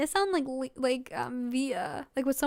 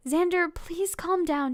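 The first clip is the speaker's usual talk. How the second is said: usual speaking voice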